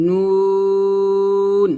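নুন held long and even; drawn-out নুন. Shown as a demonstration. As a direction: stretch নুন slowly. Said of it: "উচ্চারণ করতে হয়". (নুন টেনে উচ্চারণ)

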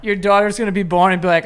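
ordinary talk

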